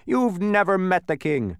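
high, loud, indignant; angry accusation. A narrator's reading. Bee challenges Humbug's boast, angrily. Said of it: "accused the bee angrily".

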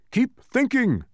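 high, sharp scold. A narrator's reading. Watchdog scolding Milo to keep thinking. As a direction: scold him to keep going. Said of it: "scolded the watchdog".

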